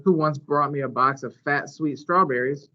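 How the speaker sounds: usual speaking voice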